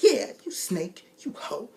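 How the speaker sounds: slow whispered venom; hissed insult. Retold or imitated. Joker hissing insults at Marcus. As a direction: hiss the insult.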